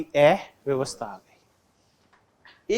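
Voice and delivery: "अव्यवस्था" stretched very slowly, rising at the end; teacher slowly dragging out the answer word; drawn-out answer. Shown as a demonstration. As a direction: hold out "अव्यवस्था" like an answer for the class to echo. Teacher stressing answer to question.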